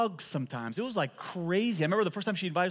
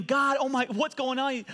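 high, louder mock cry of alarm; retold scream; 9 semitones higher, 3 dB louder, slower than usual. Preacher retells his first bite of curry, mock screaming. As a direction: act out panicking with a mouth on fire. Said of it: "I'm screaming".

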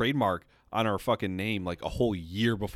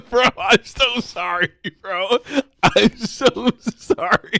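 slow, loud, laughing sorry; laughing apology; 10 semitones higher, 7 dB louder, slower than usual. Real, not acted. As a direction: apologize while cracking up.

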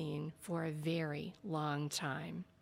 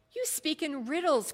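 a raised, higher cry of protest; cried out; 8 semitones higher, 4 dB louder than usual. A narrator's reading. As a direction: call it out sharply, pitched up. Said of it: "cried Balthazar".

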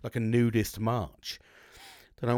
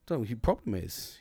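usual speaking voice

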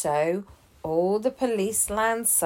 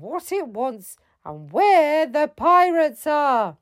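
captain shouting; 9 semitones higher than usual. A narrator's reading. Captain shouts at the panicking shipmates to calm down. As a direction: shout them out of their panic. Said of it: "the captain shouted".

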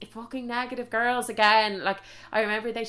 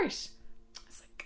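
whispered; 15 dB quieter, much slower than usual. Real, not acted. Shocked aside.

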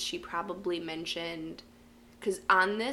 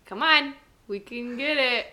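louder, higher cheering-on; urging on; 6 semitones higher, 7 dB louder than usual. Real, not acted. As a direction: cheer your partner on, loud and high. Urging co-host through a guessing game.

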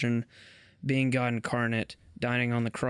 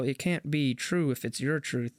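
ordinary delivery

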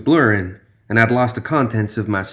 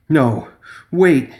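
higher, sudden cry of protest; alarmed plea; 6 semitones higher, 3 dB louder than usual. A narrator's reading. Gambler begging the thing as it grabs an arm, alarmed. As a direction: plead in alarm to stop.

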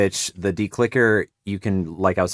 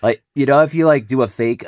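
ordinary talk, nothing marked

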